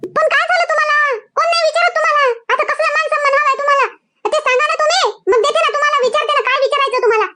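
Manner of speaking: high-pitched, irritated wife challenging her husband; exasperated. Acted. Wife snaps back at quarrelling husband. (वैतागून, चढ्या सुरात)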